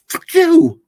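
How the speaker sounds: very high, very loud snarl; defiant shout. Retold or imitated. Young rival overthrows the old leader, loud shout. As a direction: spit it at the old king. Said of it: "And the young guy goes".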